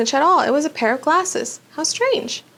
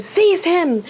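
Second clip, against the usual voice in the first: villain's scream; 7 semitones higher than usual. A narrator's reading. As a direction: scream the order at the servant. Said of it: "Voldemort screamed".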